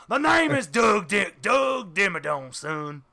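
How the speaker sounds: slow cartoon tycoon shout; Doug Dimmadome. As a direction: announce the name like a proud cartoon tycoon. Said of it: "He is Doug Dimmadome".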